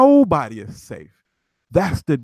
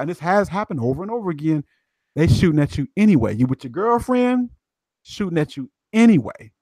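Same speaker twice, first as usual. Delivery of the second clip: ordinary talk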